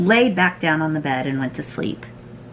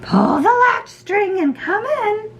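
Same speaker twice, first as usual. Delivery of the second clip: high mock-granny voice; wolf posing as grandmother. A narrator's reading. Wolf in Grandma's bed lets Red in, high fake granny. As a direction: call out briskly in a faked high granny voice. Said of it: "replied the wolf".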